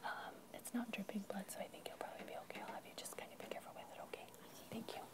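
fast unvoiced whisper. Real, not acted. Teacher calming a child about a scrape, whispered. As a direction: reassure quickly and quietly. Private reassurance about small injury.

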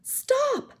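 high, loud scream of pain. A narrator's reading. Rod with his skull being squeezed, screaming. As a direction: scream for it to end. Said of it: "I screamed".

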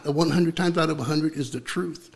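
normal talking voice

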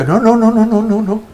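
high, rapid string of no's; panicked, backing away from the question; frantic refusal. Retold or imitated. The scared workman refusing to answer, high and frantic.

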